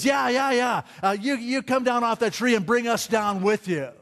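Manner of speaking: high, jeering sneer; jeering thief. Retold or imitated. Thief beside Jesus joins the crowd, high mocking jeer. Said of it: "Obviously joining the mockery".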